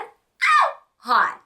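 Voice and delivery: sudden high squealed ow; playful catcall. Acted. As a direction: yelp it like a cheeky catcall. Catcalling the hot cowboy dad.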